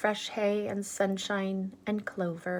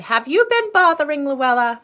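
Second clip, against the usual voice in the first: scolding mother; 8 semitones higher, 9 dB louder than usual. Acted. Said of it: "she cried".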